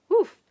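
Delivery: oof. Acted. Stink hurt by laughter.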